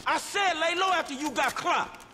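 high, yelling reproach; angry shout. Acted. Scolding his partner during the gunfight, shouting. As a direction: yell at him mid-firefight.

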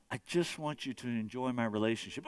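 gentle voice of Jesus. Acted. Pastor voicing Jesus answering the striver, low and gentle. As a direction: brush it off calmly and low. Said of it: "God's come".

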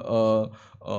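ordinary talk, nothing marked

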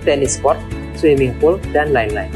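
usual speaking voice

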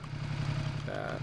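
breathy whispered repeat of "take that". Real, not acted.

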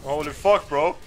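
loud stunned outburst; shocked exclamation. Real, not acted. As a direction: blurt it out in disbelief. Shocked by huge damage.

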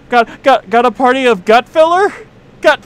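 high loud hostess call; hammed-up waiter. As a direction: call the table like a host.

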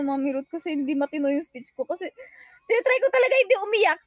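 normal talking voice

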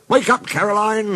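high calling voice; high-pitched storybook wake-up call; rousing call. A narrator's reading. Fielders rouse the old car.

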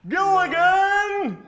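long drawn-out shout; loud shout. Pretend. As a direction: belt it out, stretched and loud. Host demands a shouted line.

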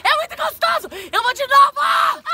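high, laughing shouts; excited. Real, not acted. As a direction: shout it out laughing, thrilled and freezing. After cold pool slide.

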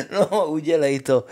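loud laugh spilling into the words; mocking laughter. A narrator's reading. Brothers laugh at Honza's gift idea, laughing scornfully. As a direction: laugh it out at the fool. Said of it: "smáli se mu".

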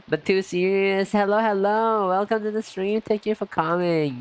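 cheerful host hello; bright welcome. Real, not acted. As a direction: welcome everyone in. Welcoming stream viewers.

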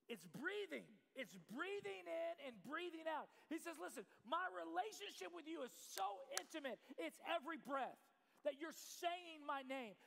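impassioned, projected sermon delivery; preaching. Real, not acted. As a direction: drive the point home to the congregation. Preaches at full force.

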